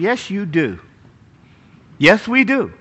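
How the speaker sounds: normal talking voice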